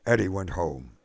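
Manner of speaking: usual speaking voice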